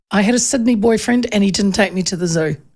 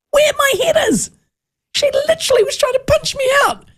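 much higher, disbelieving cry; outraged exclamation. Real, not acted. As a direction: shriek it in amazed outrage. Retelling gorilla punch, outraged.

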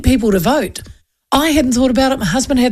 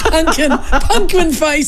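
slow, higher-pitched giggly echo; laughing; 6 semitones higher, much slower than usual. Real, not acted. Host echoes silly pet names, laughing. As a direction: draw it out through laughter.

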